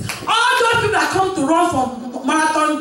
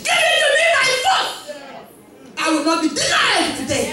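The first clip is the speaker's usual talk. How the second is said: higher, fast, shouted claim; forceful demand; 9 semitones higher than usual. Acted. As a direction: seize the blessing by force.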